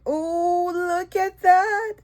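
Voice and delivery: high thrilled exclamation; high delighted gush. Real, not acted. Thrilled at resin piece.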